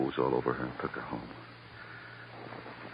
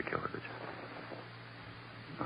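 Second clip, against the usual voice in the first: coaxing whisper; 17 dB quieter, much slower than usual. Acted. Detective coaxing a confession.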